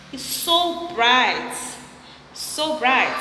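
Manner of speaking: loud, slow admiring gush; delighted, slow and loud. Real, not acted. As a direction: draw out so bright with delight. Admiring the bright wall.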